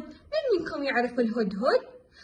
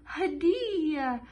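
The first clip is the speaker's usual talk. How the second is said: drawn-out word; 4 semitones higher, much slower than usual. Shown as a demonstration. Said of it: "نقرأ مع بعض".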